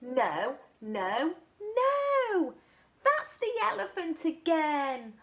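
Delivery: high, loud no no no; fed up and eager; exasperated correction. Acted. Little monkey fed up telling the butterfly no, high and loud.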